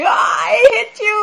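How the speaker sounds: high furious yell of hate; angry shout. Retold or imitated. Angry friend yelling at the teller. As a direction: scream it at him in a rage. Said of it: "told me".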